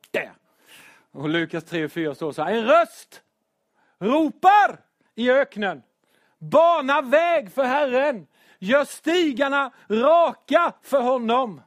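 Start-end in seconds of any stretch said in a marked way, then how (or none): 2.5-11.6 higher, louder, slow proclaiming of the verse; solemn scripture reading. Acted. Preacher reading the verse to the church, slow and loud. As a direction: call it out like a prophet in the desert. Reads the Bible verse aloud.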